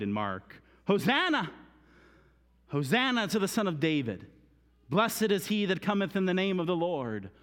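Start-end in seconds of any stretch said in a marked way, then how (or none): 0.9-1.5 high, loud shout of praise; crowd's cry. Acted. Preacher voicing the crowd in the gospels, shouted high. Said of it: "It tells us in Matthew they cried". 2.7-7.3 raised, ringing proclamation of praise; crowd's cry. Acted. Preacher voicing the crowd praising David's Son, raised voice. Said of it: "It tells us in Matthew they cried".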